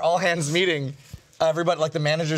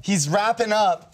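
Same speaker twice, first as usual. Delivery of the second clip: ordinary talk, nothing marked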